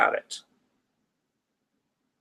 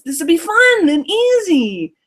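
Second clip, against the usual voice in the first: put-on much higher, slower enthusiastic child; eager little kid; 9 semitones higher, 4 dB louder, slower than usual. Reading Martin's chat reply as the eager child. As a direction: sell it like an excited kid. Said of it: "Martin says".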